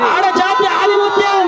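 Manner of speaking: slow, high, drawn-out ఆడ జాతి ఆనిముత్యం; grand proclamation. Real, not acted. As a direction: stretch out ఆనిముత్యం like crowning her. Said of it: "ఆడ జాతి ఆనిముత్యం repeated as proclamation". (నెమ్మదిగా, ఎత్తైన స్వరంలో ప్రకటన)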